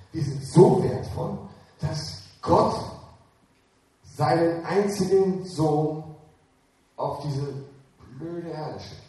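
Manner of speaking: drawn-out booming pulpit emphasis; emphatic preaching. Acted. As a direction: preach it out to the whole hall. Preacher stresses human worth.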